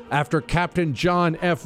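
ordinary delivery

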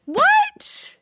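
very high, astonished protest; incredulous outburst. Real, not acted. Contestant told her sure guess is wrong, shrill disbelief. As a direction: squeak it out in disbelief, staying high.

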